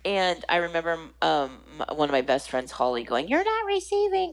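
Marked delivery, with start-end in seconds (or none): 3.3-4.3 high, singsong call from another room; friend calling out. Retold or imitated. Best friend calling from the next room while doing dishes, high-pitched teasing call. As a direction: mimic a friend calling through the house. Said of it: "she's in the other room doing dishes".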